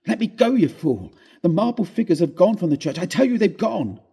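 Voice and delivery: panicked man yelling that the statues are gone; frantic shout. Acted.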